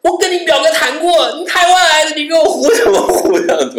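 high, loud imitated girl's scolding. Girl scolds the traveller for lying, high and loud. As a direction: play the girl telling him off.

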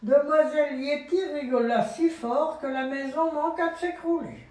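put-on lively read-aloud to a child; storybook reading voice; story narration. A narrator's reading. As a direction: read it up and bright for a little one.

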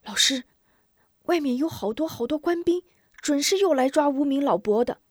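put-on high, urgent boy's voice; lightly hushed but high-pitched child reporting in. Pupil warns teacher of soldiers outside, high urgent child voice. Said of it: "悄声道".

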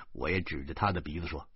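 ordinary delivery

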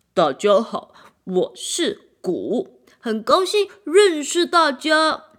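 loud, slow, cheerful greeting; the new drum greeting the orchestra, loud and friendly. A narrator's reading. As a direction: introduce yourself warmly and boldly. Drum introduces himself.

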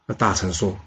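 usual speaking voice

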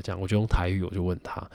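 ordinary talk

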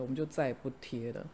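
ordinary delivery

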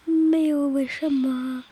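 slow put-on stonewalling; playful evasion. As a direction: stretch it out slowly, dodging the question. Child dodges mother's why question.

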